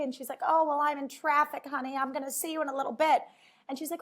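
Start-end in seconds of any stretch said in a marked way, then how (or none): 0.5-3.2 lightly voiced mom, a bit higher; a hint of a mom. Mom reassuring her daughter from traffic, lightly voiced. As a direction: play the mom lightly, breezy. Sally Field voiced in retold movie.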